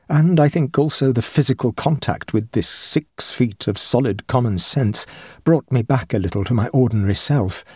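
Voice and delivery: usual speaking voice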